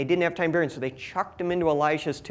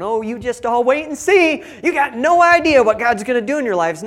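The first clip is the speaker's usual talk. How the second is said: higher and louder, a hammed-up upbeat preacher; sing-song mock optimism, louder and higher; mock cheery minister; 6 semitones higher, 7 dB louder than usual. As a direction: play the chirpy know-it-all minister. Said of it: "He's not dancing along going".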